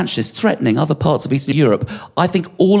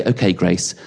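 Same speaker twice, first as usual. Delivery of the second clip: gentle storytelling dad; 7 semitones lower than usual. Retold or imitated. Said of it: "and I'd say".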